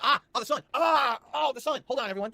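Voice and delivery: high, loud cries of over-the-top pain; mock alarm. Streamer interrupts himself to shriek at the sun, mock alarm. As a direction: yelp as if the sunlight burns you.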